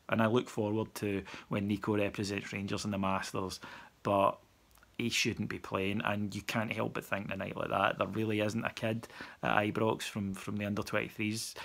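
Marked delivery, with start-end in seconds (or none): none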